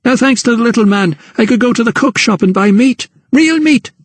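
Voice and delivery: mother to Emily, high and defensive; rebuking mother. Acted. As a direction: defend yourself hotly. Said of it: "she rebuked her daughter".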